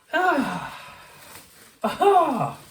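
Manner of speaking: high, drawn-out discovery; the big reveal; pleased aha; triumphant aha. Real, not acted.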